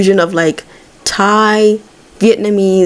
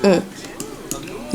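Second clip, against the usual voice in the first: normal talking voice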